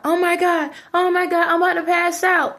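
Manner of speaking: half-hearted alarm about passing out; panicked friend. Retold or imitated. Friend on the phone, a touch of mock panic. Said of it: "They at about to pass out".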